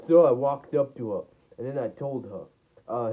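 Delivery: plain reading voice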